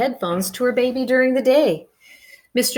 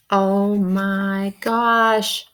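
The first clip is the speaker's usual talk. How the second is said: slow, drawn-out gasp of surprise; astonished; much slower than usual. A narrator's reading. Narrator reacting to the strange noises, stunned and slow. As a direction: let the shock stretch out.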